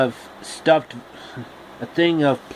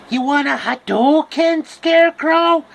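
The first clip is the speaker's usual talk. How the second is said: witch shriek; 13 semitones higher, 5 dB louder than usual. Acted. Witch taunts Scarecrow.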